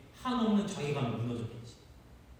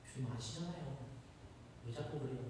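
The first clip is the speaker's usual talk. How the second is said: much softer, wounded Peter; hurt hush; 4 semitones lower, 16 dB quieter than usual. Acted. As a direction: plead quietly, why keep asking.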